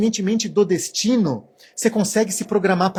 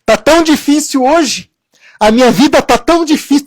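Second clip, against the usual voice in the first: higher, louder whiny lament; whiny complaining; 6 semitones higher, 9 dB louder than usual. Retold or imitated. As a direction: moan about how hard life is. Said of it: "e você fala".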